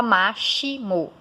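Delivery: "máximo" with X as a sh sound, MA-SHI-mo; wrong pronunciation. Shown as a demonstration. Teacher shows learners what not to say, "máximo" mispronounced with sh. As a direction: say "máximo" in separate syllables, middle one "shi". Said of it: "Você não fala máximo".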